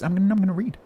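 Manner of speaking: high, loud, insisting on reading. Real, not acted. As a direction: shout it over the chat. Insisting over chat questions.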